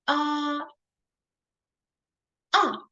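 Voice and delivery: high level ah, then falling ah; first versus fourth tone. Shown as a demonstration. Teacher contrasting the first and fourth tones. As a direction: contrast a flat ah with a dropping ah. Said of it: "first and the last tone".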